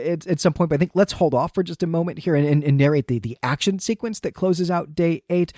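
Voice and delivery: ordinary talk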